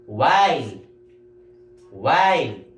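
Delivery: "while" stretched on the "eye" glide, falling; long I sound. Shown as a demonstration. Teacher modelling the next word, emphatic long I. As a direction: model "While" twice, each apart and falling. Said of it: "you have to pronounce it as I".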